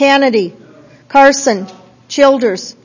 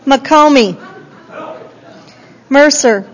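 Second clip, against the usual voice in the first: ordinary talk, nothing marked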